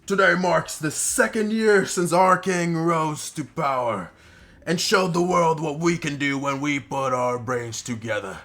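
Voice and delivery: loud, high, slow rallying oration; drunk goblin chief's speech. Acted. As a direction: address the horde grandly, stretching the words out. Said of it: "he seems to be pretty fucking drunk".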